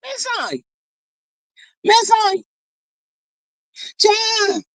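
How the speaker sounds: high, falling calls of disapproval; scandalized reproach. Real, not acted. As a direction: call her out in playful disbelief, pitched high. Scolding friend over drinking beer.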